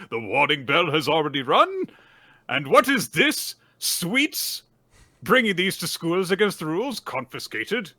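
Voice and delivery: high, loud indignant schoolmaster act; scolding teacher. Retold or imitated. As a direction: imitate an outraged master catching rule-breakers. Imitates teacher scolding pupils.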